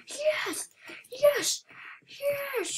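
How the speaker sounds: high-pitched bursts of triumph; excited celebration. Real, not acted. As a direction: squeal each one in delight. Celebrating in game.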